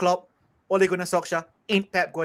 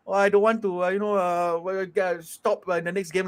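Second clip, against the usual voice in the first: flat robotic player voice. Retold or imitated. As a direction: speak like a robot, no feeling. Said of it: "'cause he speaks like a robot".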